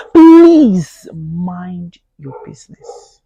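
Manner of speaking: very slow, loud, stretched please mind your business; pleading slow command; drawn-out plea. Real, not acted. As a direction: lean on every word, slow and loud. Pleading scolding.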